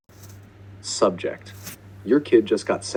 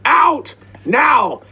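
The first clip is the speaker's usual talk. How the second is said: high, loud shrieked commands; unhinged scream; 9 dB louder than usual. Retold or imitated. Kid ordering the homeowner out, screaming wildly. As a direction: shriek each word like an air raid siren. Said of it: "lost their mind and screamed".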